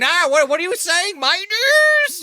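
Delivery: high, loud outraged squeal; shocked commenter. Retold or imitated. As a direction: squeal like a scandalized viewer. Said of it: "someone's already in the comments".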